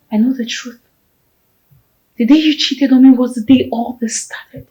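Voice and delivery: normal talking voice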